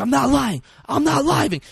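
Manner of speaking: high, loud desperate insistence; intense denial. Retold or imitated. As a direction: protest as if your life depends on it, imitated. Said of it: "It's so fucking intense".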